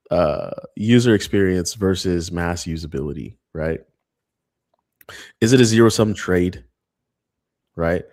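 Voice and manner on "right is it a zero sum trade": normal talking voice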